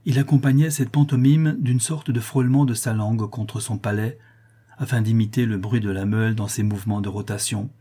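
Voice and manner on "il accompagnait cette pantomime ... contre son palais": usual speaking voice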